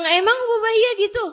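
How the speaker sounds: high, loud, fast incredulous question through laughter; surprised disbelief. Retold or imitated. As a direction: blurt it out in laughing shock. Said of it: "Saya bilang".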